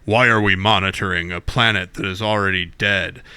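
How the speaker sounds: a hint of a low-voiced officer; Worf impression. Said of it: "and Worf says".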